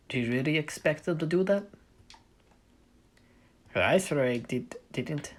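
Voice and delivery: light, bad Russian accent. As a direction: narrate in a shaky Russian accent. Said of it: "I'll stop doing my Russian accent".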